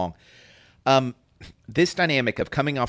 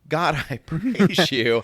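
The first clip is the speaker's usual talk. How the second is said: joyful praise; 6 semitones higher, slower than usual. Retold or imitated. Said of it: "And he's like".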